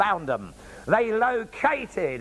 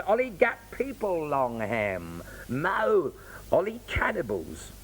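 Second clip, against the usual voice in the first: Bislama pidgin reading. Shown as a demonstration. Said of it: "Pidgin first".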